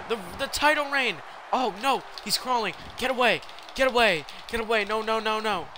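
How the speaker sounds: high, urgent gamer panic; real mid-game alarm, not shouted; panicked pleading. Real, not acted. Pleads as opponent crawls toward title.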